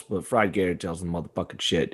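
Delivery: ordinary talk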